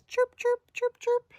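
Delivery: very high, short chirps; high-pitched, loud little animal peeps; critter chirping. Acted. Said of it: "It says".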